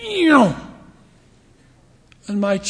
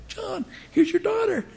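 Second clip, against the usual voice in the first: much higher, called across the stands; calling out; 12 semitones higher, 5 dB quieter than usual. Retold or imitated. Bishop calling across the arena to the girl's father. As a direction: call to the dad up the rows. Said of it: "yelled over to her dad".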